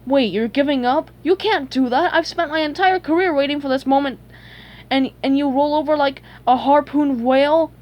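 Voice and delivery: indignant protest. Acted. Plankton robbed of his long-awaited vengeance, indignant protest. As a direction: object urgently, rushing the middle.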